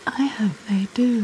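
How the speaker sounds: intimate whispered reply; seductive whisper. Acted. Wife hoping things get out of hand, seductive whisper. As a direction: whisper it as an invitation.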